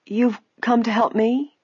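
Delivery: high, slow, disbelieving question; unbelieving. A narrator's reading. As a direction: ask as if it can't be true. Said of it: "she repeated unbelievingly".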